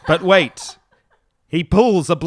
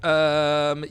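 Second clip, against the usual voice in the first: normal talking voice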